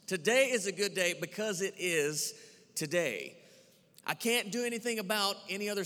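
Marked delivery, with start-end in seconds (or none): 0.1-3.4 "Today" punched, a little louder, "today" landed with a fall at the end; emphasis. Shown as a demonstration. Pastor showing the church how to stress it, emphatic. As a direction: lean hard on "Today" and finish with a falling "today". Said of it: "Put some emphasis on it".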